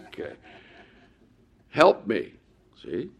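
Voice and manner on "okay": ordinary talk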